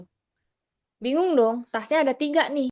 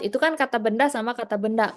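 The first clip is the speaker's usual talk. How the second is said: ordinary talk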